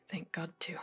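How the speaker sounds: softly, falling away almost to a whisper; low, hushed, intimate admission; tender hushed reply. Acted. Woman answering his love confession, hushed and tender.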